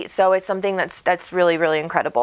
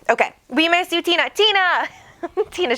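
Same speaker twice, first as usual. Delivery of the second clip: higher, eager calling out a name; excited call; 8 semitones higher than usual. Real, not acted. Host calls out to viewer Tina, high and bright. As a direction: call her name happily across the room.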